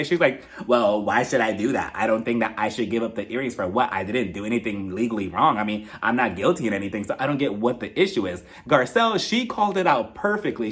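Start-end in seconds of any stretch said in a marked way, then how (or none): 0.7-8.4 low-pitched, mildly defensive retort. Retold or imitated. Erica answering the question about the earrings, mildly defensive. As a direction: retell her defensiveness in a lowered voice. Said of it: "she becomes defensive right away".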